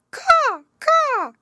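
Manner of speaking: high, loud cawing croak; raven caw. Acted. Raven greets the lost girl with a loud high caw. Raven's cry in fairy tale.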